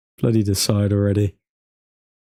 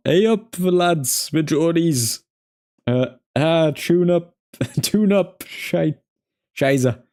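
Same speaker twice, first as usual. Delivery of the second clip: higher-pitched put-on Geordie; mock Geordie accent; 6 semitones higher, slower than usual. Streamer jokes about being from Newcastle, in a mock Geordie accent. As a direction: imitate a Newcastle fan in a hammed-up Geordie accent. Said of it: "Admin from Newcastle, definitely not".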